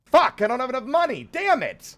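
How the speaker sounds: high, loud outburst of frustration; real exasperated shout; frustrated shout. Real, not acted. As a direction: yell it, fed up at being broke. Short of money while shopping.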